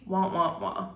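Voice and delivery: mouthed wah-wah-wah letdown sound; sad trombone. Retold or imitated. Mimics sad trombone after letdown.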